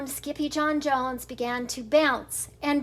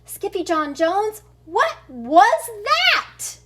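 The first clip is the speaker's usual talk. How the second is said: high, loud, slow startled question; 7 semitones higher, 6 dB louder, slower than usual. Acted. Kitten startled by his own reflection, high and loud. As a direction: gape at the mirror.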